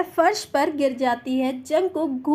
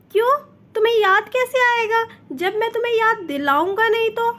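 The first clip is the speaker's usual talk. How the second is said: higher, faster playful tease; teasing voice; 7 semitones higher, 4 dB louder, faster than usual. A narrator's reading. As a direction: tease her, pushing her buttons. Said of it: "टीजिंग voice के साथ कहता है".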